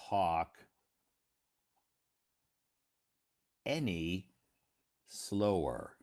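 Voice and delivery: painfully slow, very long gaps between words; sarcastically slow. Shown as a demonstration. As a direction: drag it out word by word as a joke. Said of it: "I'm just being sarcastic".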